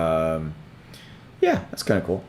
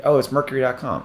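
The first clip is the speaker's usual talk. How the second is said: usual speaking voice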